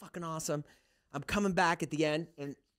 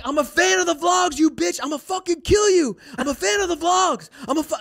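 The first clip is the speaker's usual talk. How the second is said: high, loud shouted fan rant; relentless mock-hostile hollering; nice but aggressive; 9 dB louder than usual. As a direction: yell praise and threat alike, imitating a crazed admirer.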